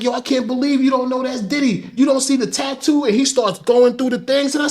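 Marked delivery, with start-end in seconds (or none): 0.0-3.0 high-pitched incredulous teasing; amused disbelief. Retold or imitated. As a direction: marvel at him in a much higher voice. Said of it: "They break out laughing at me".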